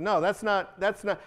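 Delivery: ordinary talk, nothing marked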